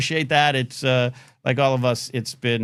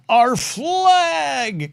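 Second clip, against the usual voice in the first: mocking patriotic shout; 12 semitones higher, 6 dB louder than usual.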